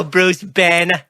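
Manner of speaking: high, put-on voice saying the name; mock know-it-all. Friend mocking the Banner mix-up, high put-on voice.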